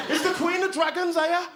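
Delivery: high-pitched comic Brummie voice; thick Brummie accent, high. Acted. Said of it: "one Brummie to pop up from behind a tree".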